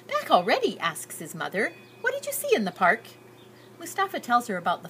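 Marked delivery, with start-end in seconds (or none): 0.1-0.8 higher, louder surprised mother; a mother caught off guard by the quick return; mild surprise. A narrator's reading. Mother greeting her son back early, lightly surprised. 2.0-3.0 much higher, quick and louder; a bright, curious mother voice; eager mother. A narrator's reading. Mother eagerly asking her son about the park.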